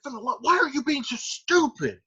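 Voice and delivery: high, exasperated mock yell; half-yell it in exasperation; kinda yelling. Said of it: "he's like, kinda yelling".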